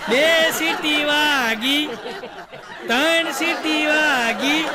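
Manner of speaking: very slow mechanical announcing voice; talking cooker. Pretend. Smart cooker announcing whistles to the household, slow robotic. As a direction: count the whistles like a machine, then urge ઉતારો. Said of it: "cooker બોલશે".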